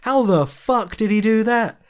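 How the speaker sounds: bursting with amazement at the fight; laughing disbelief. Retold or imitated. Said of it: "It's like".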